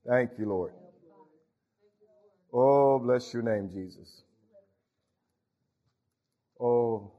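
hushed devotional prayer. Acted. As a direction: pray it humbly to God. Prayer after sermon.

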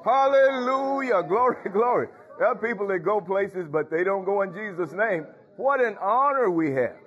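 high, ringing preacher's cadence; sing-song revival preaching; fervent preaching. Acted. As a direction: preach it out to the congregation. Preaching at full force.

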